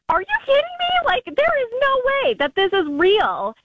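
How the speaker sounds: high-pitched indignant outburst; fuming disbelief. Retold or imitated. Retelling that now she is fuming, high indignant disbelief. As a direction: shoot the pitch right up, outraged. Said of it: "'Cause now I'm fuming".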